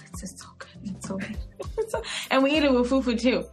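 ordinary delivery

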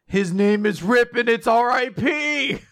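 high, slow, hammed-up; amused mock reaction. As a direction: spell it out with mock wonder. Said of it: "I was like".

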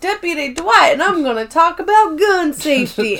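high, hammed-up cowboy greeting; cowboy deputy. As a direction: play the hokey sheriff riding in. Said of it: "pretending to ride on a horse".

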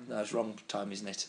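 ordinary delivery

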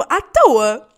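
À TOA stretched out slow and loud; exasperated emphasis. Real, not acted. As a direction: drag out "à toa" with a big push. Exasperated at the random questions. ("à toa" arrastado e bem alto)

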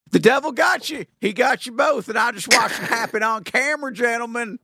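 high, loud hellfire preacher. Pretend. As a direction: proclaim it like a revival preacher. Mock preacher accusing the others.

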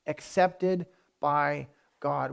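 very slow, each word set apart; measured and weighty, drawn out for emphasis; slow, deliberate repeat. Real, not acted. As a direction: let every word land separately. Repeats phrase slowly for weight.

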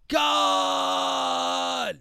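long drawn-out mock roar of a name; big drawn-out film-hero yell; imitated movie scream.

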